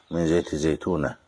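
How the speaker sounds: ordinary talk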